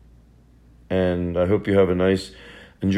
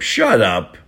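usual speaking voice

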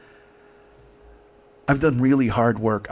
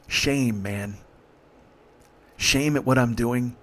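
ordinary talk

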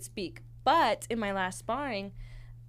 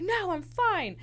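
mock yell; 10 semitones higher, slower than usual. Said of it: "I'm yelling at everyone".

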